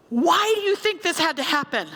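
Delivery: high-pitched, imploring question; distraught outcry. Retold or imitated. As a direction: act out a stranger crying out for a reason. Acted distraught question from crash victim.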